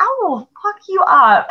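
a high, half-laughing threat; hammed-up tough-girl threat; mock threat. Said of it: "I was like".

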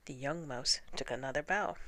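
plain reading voice

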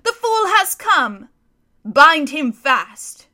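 slow, high, loud triumphant order; commanding shout. Acted. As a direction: crow over the captured lion. Witch ordering Aslan bound.

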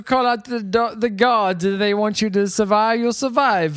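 put-on Bahamian accent, words crammed together; mock Bahamian. Podcaster playing a witch doctor calling gods, mock Bahamian accent. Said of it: "it's just the language of the Bahamians".

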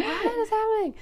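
very high, bewildered squeal of a question; exaggerated startled disbelief; mock alarm. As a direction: act out comic panic, pitch shooting up. Acted past alarm at sudden darkness.

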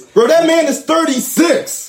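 can't believe what he's hearing; incredulous. Real, not acted. Friend objecting to LeBron hype, incredulous and high.

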